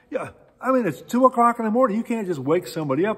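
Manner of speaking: high, loud, quick objection; incredulous protest. Retold or imitated. Road manager objecting to Bing waking strangers at two, incredulous protest.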